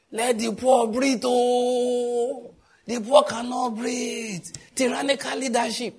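mocking protesters. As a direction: ham up the complainers' outcry slowly.

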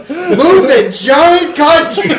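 high loud slow yell; shouting. Retold or imitated. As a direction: bellow it out. Shouted movie quote.

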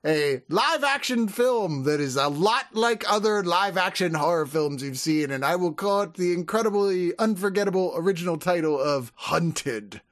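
a mild mock French accent, twirling a fake mustache; hammed-up French accent. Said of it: "I'll curl a fake mustache".

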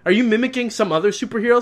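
loud, high furious questioning; angry rant. Real, not acted. Ranting about Superman's costume, loud and high. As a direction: tear into the film, shouting.

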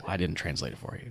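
hushed, low and soft; quiet low mumble. Retold or imitated. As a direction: mutter it low under breath. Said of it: "he's like".

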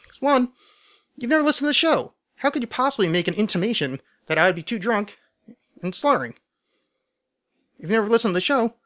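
high-pitched mock outrage; mock indignant. Real, not acted. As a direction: act offended, pitch shooting up. Rebutting a jabby listener question.

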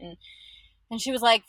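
ordinary delivery

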